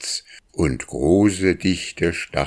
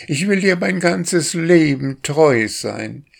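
much higher, stress on dir; courting drake, more ardent; 11 semitones higher, 4 dB louder than usual. A narrator's reading. Drake repeats his vow to the duck, higher and more ardent. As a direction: lift it high and lean on dir. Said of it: "machte der Wildente Komplimente".

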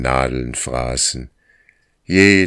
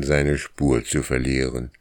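plain reading voice

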